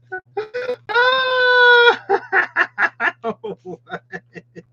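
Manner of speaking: high screaming burst of laughter; shrieking laugh. Real, not acted. Cracking up at card.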